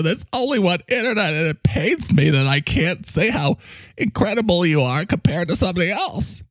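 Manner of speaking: high, overwrought adoring sidekick; gushing microphone character. Acted. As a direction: heap praise in a pained, excitable character voice. Mike gushes over Ori.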